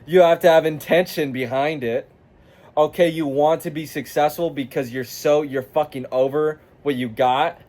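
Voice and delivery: ordinary talk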